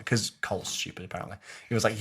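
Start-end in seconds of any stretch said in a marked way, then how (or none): none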